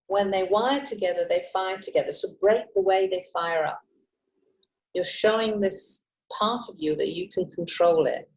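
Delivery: ordinary talk, nothing marked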